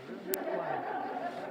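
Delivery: slow, drawn-out sing-song coo; slow doting coo to a toddler; sing-songy parentese. Retold or imitated. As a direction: stretch it out like cooing to a baby. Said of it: "that sing-songy thing".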